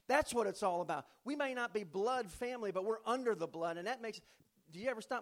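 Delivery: loud pulpit declaration; preaching. Acted. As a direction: proclaim it to the whole church.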